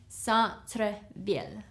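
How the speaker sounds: Centre-ville slowed, "san-truh-veel"; Centre-ville, gentle careful repeat; slow soft teaching repeat; slow French model. Shown as a demonstration. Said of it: "This is downtown".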